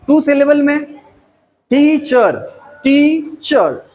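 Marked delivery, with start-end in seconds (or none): 1.7-3.9 teacher broken into two beats, टी, then चर; split into syllables. Shown as a demonstration. Teacher showing two sounds in teacher, split into syllables. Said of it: "टू sound".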